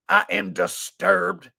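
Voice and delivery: ordinary delivery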